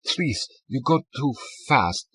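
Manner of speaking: put-on slow German-accented plea. A narrator's reading. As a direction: plead slowly, German accent.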